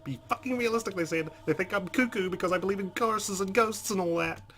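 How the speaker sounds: loud, high-pitched indignant rant; frantic, exasperated tirade; panicked outburst. Acted. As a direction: rant at the top of the voice, fed up and scared. Character's panicked outburst, leaving haunted house.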